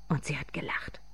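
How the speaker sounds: soft, near-whispered aside; quiet, breath-led narration; hushed narration. A narrator's reading. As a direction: drop to a hush. Hushed remark within louder narration.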